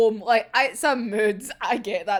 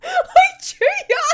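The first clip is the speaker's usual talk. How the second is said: a much higher, louder fan squeal; squealing the name; excited squeal; 8 dB louder than usual. Real, not acted. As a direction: shriek his name in delight. Fan exclaiming at a character.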